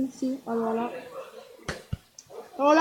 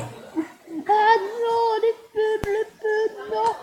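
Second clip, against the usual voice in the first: mock wail; 8 semitones higher, 3 dB louder, much slower than usual. Ads pop up again.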